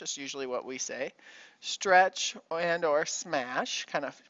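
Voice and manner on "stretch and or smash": slow key terms. Shown as a demonstration. Teacher repeating key terms.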